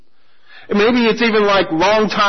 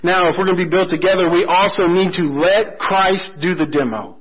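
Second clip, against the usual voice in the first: ordinary talk, nothing marked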